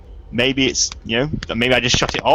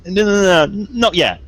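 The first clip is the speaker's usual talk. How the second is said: rapid-fire raised string of no's; hasty refusal; 7 semitones higher, 5 dB louder than usual. Acted. Stopping the device from terraforming yet, hasty refusal. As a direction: cut in to stop it at once.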